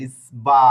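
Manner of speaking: ইসবা said clearly and loudly with a lift at the end; sounded-out word. Shown as a demonstration. Teacher modelling a Spanish word for the class, loud and clear. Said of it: "এই উচ্চারণটার মতো আসবে".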